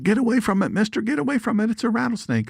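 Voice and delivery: high, panicked warning shout; frantic bystander yelling at the snake handler; mock panicked yell. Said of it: "And they said".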